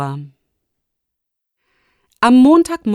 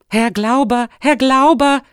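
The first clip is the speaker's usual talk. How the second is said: higher, excited double call, louder second time; 6 semitones higher, 5 dB louder than usual. A narrator's reading. Boy bursting into the rector's office, calling out. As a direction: call his name urgently. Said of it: "rief Robert".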